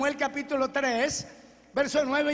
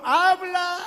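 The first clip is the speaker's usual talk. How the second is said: loud, raised, drawn-out call; proclaimed answer; 5 semitones higher, 7 dB louder than usual. Acted. Samuel answering Jehová as the preacher reads, loud proclamation. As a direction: call it out boldly from the pulpit.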